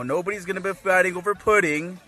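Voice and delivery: ordinary talk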